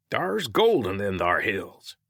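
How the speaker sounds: put-on slow, hammy old-prospector accent; mock Old West prospector accent; bad prospector accent. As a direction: drawl it in a corny prospector accent. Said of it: "He dropped the Bat accent".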